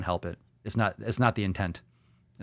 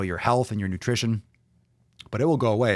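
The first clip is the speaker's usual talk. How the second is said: ordinary talk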